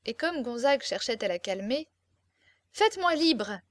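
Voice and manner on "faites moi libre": higher, insistent demand; acted defiant cry; rebellious. A narrator's reading. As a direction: refuse to be calmed. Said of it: "en pleine révolte".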